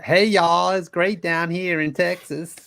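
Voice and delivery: Australian trying a big slow Southern twang; Southern accent. Pretend. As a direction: put on a hammed-up Texas accent. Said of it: "trying to do a Southern accent".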